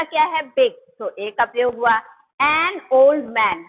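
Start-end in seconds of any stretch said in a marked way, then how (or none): 2.4-3.6 a big elephant drawn out very slowly and a bit louder; slow clear example. Shown as a demonstration. Teacher dictating an example to students, slow and clear. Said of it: "अब इसमें देखिए".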